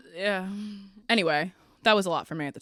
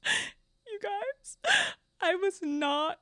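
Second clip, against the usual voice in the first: tearful laughter; 11 semitones higher, much slower than usual. Real, not acted. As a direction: say it through helpless, near-crying laughter. Laughing over the insult story.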